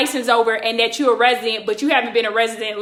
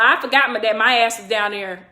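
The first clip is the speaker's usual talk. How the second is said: ordinary delivery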